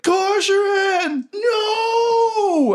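high, slow crying shout; anguished cry. Acted. Sid crying out as his friend falls. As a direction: wail the name in despair. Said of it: "he's like".

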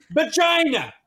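high, loud blurted word, imitating; mock a sudden loud blurt. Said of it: "then just hears Jeremy".

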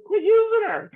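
high, loud cry of protest; retold outburst. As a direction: yell it out in exasperation high and loud. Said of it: "I said".

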